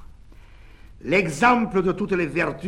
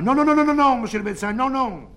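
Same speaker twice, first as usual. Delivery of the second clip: very high, loud string of nos; frantic refusal; 11 semitones higher, 7 dB louder, faster than usual. Acted. Devout women begging the doctor, shrill loud refusals. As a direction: shriek the nos in a panic.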